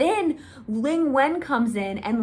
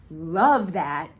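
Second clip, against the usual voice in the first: ordinary delivery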